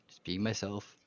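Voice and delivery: softly under the breath; quiet murmur. Shown as a demonstration. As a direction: let it fall away quietly. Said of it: "repeats it differently".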